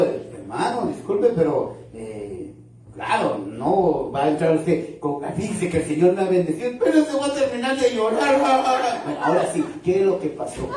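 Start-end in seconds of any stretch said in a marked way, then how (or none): 5.3-9.0 mock churchgoer. Mocking a tearful churchgoer.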